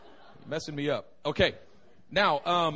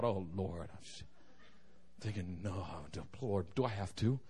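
low, soft, weary plea; reluctant inner groan; 12 semitones lower, 12 dB quieter than usual. Retold or imitated. Guest asked to pray thinking to himself, low reluctant plea. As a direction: grumble it privately to God.

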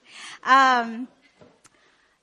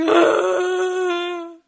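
hammed-up wailing sob, pitched up; breaking down in tears; 4 semitones higher, 3 dB louder, slower than usual. New mom acting out her meltdown, tearful wailing. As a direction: wail it as mock crying. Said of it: "I'm breaking down in tears".

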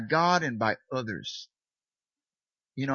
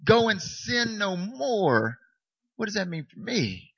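pleading protest; 7 semitones higher than usual. Retold or imitated. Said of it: "I'm like".

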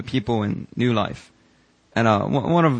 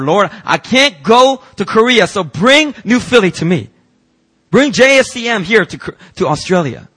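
fervent prayer; 7 semitones higher, 6 dB louder than usual. Acted. Pastor voicing Sasha's prayer to the church, fervent and pleading. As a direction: pray it out with longing.

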